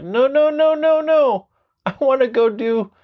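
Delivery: high-pitched whiny no, no, no; childish protest. Retold or imitated. Sean protesting to his guru, high whiny kid voice. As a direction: whine like a kid refusing.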